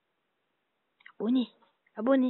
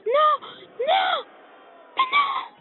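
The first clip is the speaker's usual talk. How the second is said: rising anguished screams of no; despairing scream; 11 semitones higher, 5 dB louder, slower than usual. Acted. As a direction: scream no louder and higher each time. Losing a game, screaming no.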